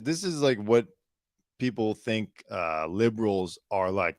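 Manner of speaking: normal talking voice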